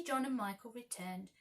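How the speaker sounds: plain reading voice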